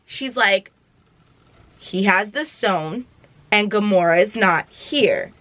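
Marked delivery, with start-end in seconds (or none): 1.8-5.3 slow and low, each word set apart; measured, spaced-out delivery; slow, deliberate repeat. Retold or imitated. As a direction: spell it out slowly for someone not getting it. Sister repeats line slowly, deliberately.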